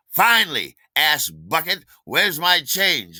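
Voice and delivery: loud, high, hammed-up scolding; angry demand. Gregory turns on the late errand runner, angry demand. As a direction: act out an exasperated boss's outburst, stretching the last words.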